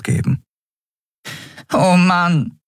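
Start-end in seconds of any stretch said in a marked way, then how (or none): 1.7-2.6 high-pitched little groan of dismay; small creature giving up hope; dejected fairy. Acted. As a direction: lament in a tiny high voice. Fairy despairs, hides face.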